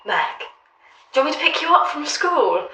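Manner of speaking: high, loud cheery mum; mum calling. Retold or imitated. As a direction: sing out like an eager mum. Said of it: "and she goes".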